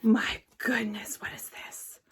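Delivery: hushed, breathless whisper of wonder; awed whisper. Real, not acted. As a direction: whisper it like you can't believe it. Awed by a new find.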